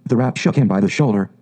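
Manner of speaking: plain reading voice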